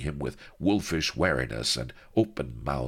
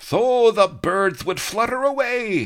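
much higher, loud gloating voice; gloating villain; 8 dB louder than usual. Acted. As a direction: crow over them like a mad don. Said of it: "he gloated".

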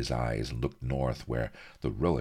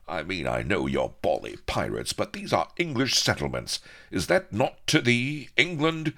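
very high, impassioned plea; indignant patriotic hero; 14 semitones higher, 5 dB louder than usual. A narrator's reading. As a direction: climb into high-pitched patriotic fervour.

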